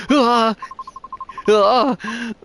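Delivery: frightened cries. Acted. Terrified cries during attack.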